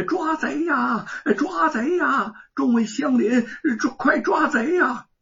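high, loud, crying shout; shouting alarm. A narrator's reading. Woman banging a basin to rouse neighbours, loud shout. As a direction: cry out for the neighbours to come. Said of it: "一边大喊".

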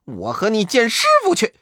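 high, fast monkey challenge; daring the rival to come along; quick high taunt; brash Monkey King. Acted. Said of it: "口中不住地说".

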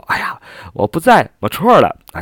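sheepish self-mockery. Auntie answering a boy who called her young, mildly sheepish. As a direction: play a mild awkward laugh-it-off reply. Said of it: "然后讪笑道".

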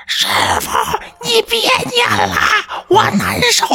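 high, drawn-out tormented wail; mimicked possessed woman pleading in agony; wailing plea. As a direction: beg in a thin, strained high cry. Woman begging the master to stop.

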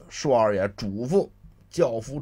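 usual speaking voice

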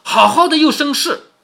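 mildly acted vexed outburst; exasperated. A narrator's reading. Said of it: "拍手叹气说".

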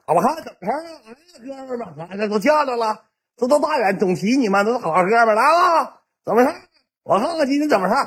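high-pitched, put-on swagger; strained high voice of a grand entrance; swaggering street big shot. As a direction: imitate a cocky tough guy greeting the boys. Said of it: "他就总整那个社会凯旋那出".

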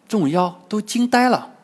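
ordinary delivery, nothing marked

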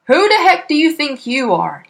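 loud, angry shout. A narrator's reading. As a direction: roar at the cheeky child. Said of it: "he shouted".

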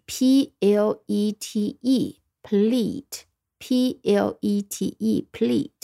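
letters of "plete" spelled out slowly, one by one; slow spelling. Shown as a demonstration. Teacher spelling for students, very slowly. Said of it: "作为钥匙的E".

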